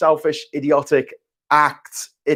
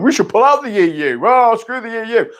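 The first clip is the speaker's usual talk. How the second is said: higher, put-on politician voice lightly mocked; 8 semitones higher than usual. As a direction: parody a slick politician who switches sides. Mock politician flip-flopping.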